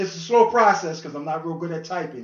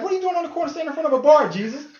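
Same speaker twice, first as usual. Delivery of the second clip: mock onlooker; 12 semitones higher, 7 dB louder, much faster than usual. Onlooker questions Jesus, fast high.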